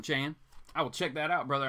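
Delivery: ordinary talk, nothing marked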